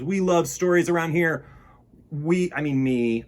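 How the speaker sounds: ordinary delivery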